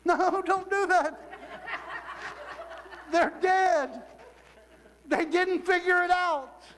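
a high teasing voice shooting down the silly idea; mock scolding. Mocking own joke, high voice.

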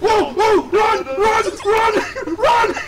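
panicked shouting. Real, not acted. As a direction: scream at them to run. Slender Man appears, panic.

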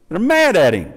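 ordinary talk, nothing marked

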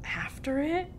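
hushed questioning echo; whispered. A narrator's reading. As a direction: whisper it doubtfully. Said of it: "we all whispered".

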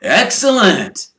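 high, loud and slow with glee; delighted twins. Acted. Fred and George thrilled at Knockturn Alley, high and gleeful. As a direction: relish the naughtiness. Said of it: "said Fred and George together".

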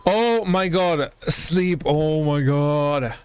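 ordinary talk, nothing marked

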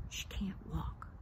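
breathy hushed whisper, slow. Retold or imitated. Narrator whispers to the maitre d' that his friend can't walk. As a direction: lean in and whisper it to the waiter.

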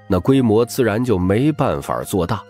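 ordinary talk

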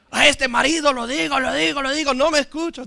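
high, repetitive imitated griping; mock nagging wife. Wife complaining to pastor about husband, high mock nagging.

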